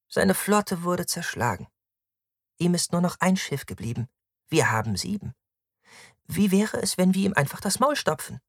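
high-pitched dismissive woman; cruel, impulsive woman, pitched high; scornful Victra, impatient. Acted. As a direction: push for a fight in a high voice. Narrator voices Victra.

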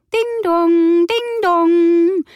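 high, loud sung ding dong twice; doorbell chime. A narrator's reading. The doorbell rings a second time, high and loud. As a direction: ring it out like a bell.

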